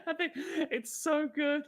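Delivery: high, laughing through the words; real giggles breaking up speech; helpless laughter. Real, not acted. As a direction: squeak it out while cracking up. Cracking up at own bit.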